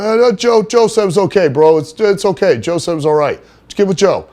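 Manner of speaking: high, loud stammering bro voice; Johnny Canine's voice. Retold or imitated. Johnny telling the store clerk it's fine, in a high loud bro voice. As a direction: pitch way up and fumble the excuse like a sheepish meathead.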